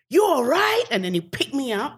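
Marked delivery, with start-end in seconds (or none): 0.1-0.8 high, loud check on someone fallen; Will Smith. Retold or imitated. Star to the writer who fell on set, high concerned imitation. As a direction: imitate a startled star asking after you. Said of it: "the first thing Will goes".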